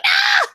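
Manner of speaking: short high-pitched "Ah!" scream; imitated scream. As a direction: yelp it like a freaked-out cartoon character. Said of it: "they're all screaming and, like, drooling".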